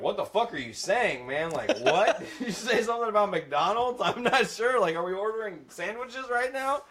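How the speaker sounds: high, baffled squawk with a laugh; confused customers. Retold or imitated. Callers baffled by the sick manager on the phone, high and incredulous. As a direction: play the lost caller who can't understand a word. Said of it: "people are like".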